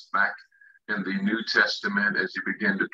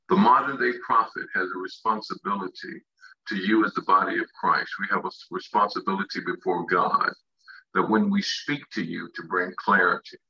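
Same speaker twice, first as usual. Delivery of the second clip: usual speaking voice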